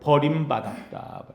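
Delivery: ordinary delivery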